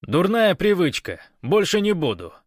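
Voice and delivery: high, loud excuse; shouted apology. A narrator's reading. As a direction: shout a promise to behave. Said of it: "крикнул он".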